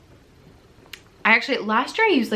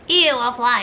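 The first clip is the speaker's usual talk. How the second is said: put-on higher, grossed-out child reaction; mock disgust; 4 semitones higher, 5 dB louder than usual. Kids reacting to a fly, mock disgust. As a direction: go up with a playful "ew".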